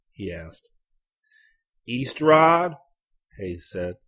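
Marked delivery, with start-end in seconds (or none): 1.9-2.8 loud, high, insistent repeat; almost shout the town name. A narrator's reading. Repeats name again, louder.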